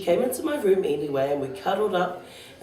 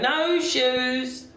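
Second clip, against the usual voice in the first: put-on high, drawn-out childish protest; mild shout; 7 semitones higher than usual. A narrator's reading.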